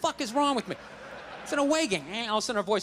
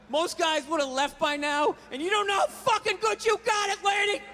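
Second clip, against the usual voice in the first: higher, hammed-up dad voice; imitating dad; 6 semitones higher than usual. Dad lecturing his wife, hammed-up. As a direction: play the grumpy father. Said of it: "one of his catchphrases".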